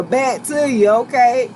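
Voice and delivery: ordinary talk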